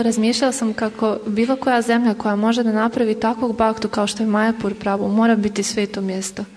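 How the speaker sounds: ordinary delivery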